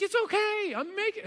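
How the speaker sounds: put-on high, excited little-boy voice; happy kid. Eight-year-old son cheering up after dad's hug, imitated happy excitement. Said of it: "he's all happy and everything's good".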